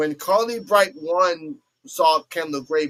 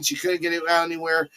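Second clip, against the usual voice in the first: normal talking voice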